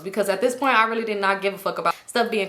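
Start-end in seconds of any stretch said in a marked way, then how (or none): none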